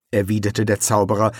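plain reading voice

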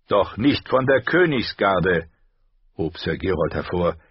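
plain reading voice

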